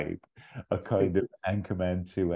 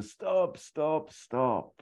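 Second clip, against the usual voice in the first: high commanding stop, falling each time; Bowie's stop; 11 semitones higher, much slower than usual. Acted. Bowie cutting the battle short, high dramatic stop. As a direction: call a halt in a high theatrical voice. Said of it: "Bowie coming out with a".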